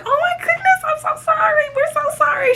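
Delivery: high, whiny, near-crying sorry; mock shocked apology. To Brainiac after the spilled drink, high whiny apology.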